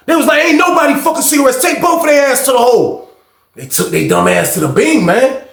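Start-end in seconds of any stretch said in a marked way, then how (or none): 0.4-3.1 high, shouted dismissive order; guard's shout. Retold or imitated. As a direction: yell it like a fed-up officer. Guards ordering both taken away.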